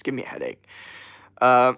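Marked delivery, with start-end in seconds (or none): none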